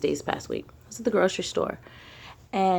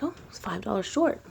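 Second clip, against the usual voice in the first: hushed whisper, no voice; 4 semitones higher, 3 dB quieter than usual. Retold or imitated. Noticing the missing cash, whispered to herself. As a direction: breathe it out as a quiet realization. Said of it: "I was like".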